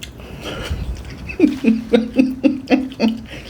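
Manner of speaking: long soft unvoiced laugh; breathy laugh. Real, not acted. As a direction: laugh quietly for a while. Laughing at memory.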